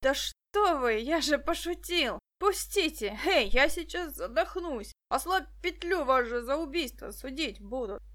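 choking man bargaining in panic; desperate pleading. Acted. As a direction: beg them to loosen it.

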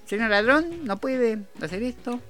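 high, timid little voice; vocecita de Domingo Cavallo. Acted. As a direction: plead politely in a small high voice. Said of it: "claro, con esa vocecita". (vocecita aguda y apocada)

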